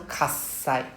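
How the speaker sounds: slow kasai with a held s break; pause at the double s. Shown as a demonstration. Teacher models kasai slowly with the doubled s. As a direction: kasai said as kas, a stop, then sai. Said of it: "You can hear the pause in between the two S".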